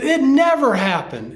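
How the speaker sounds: put-on high-pitched kids' indignant denial; imitated protest. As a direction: mimic a child's disbelieving protest. Class protests in retold quarrel.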